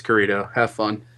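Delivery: ordinary delivery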